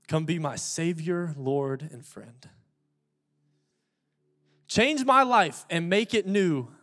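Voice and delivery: slow, measured prayer lines; leading a congregation in prayer. Acted. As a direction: pace it for the crowd to repeat.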